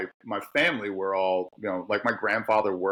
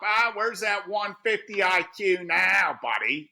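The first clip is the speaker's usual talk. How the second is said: mocking coworker; 10 semitones higher, slower than usual. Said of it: "then you get".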